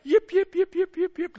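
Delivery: very high, shrill yaps; high little-dog yip-yip; yipping little dog. Retold or imitated. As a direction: yap like a tiny dog. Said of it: "Little dog bark".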